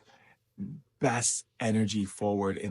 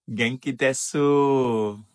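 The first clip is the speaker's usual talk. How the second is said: slow, loud, raised Japanese greeting; greeting call; 4 semitones higher, 6 dB louder, slower than usual. Real, not acted. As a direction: draw out a big hello to Osaka. Greeting a viewer from Osaka.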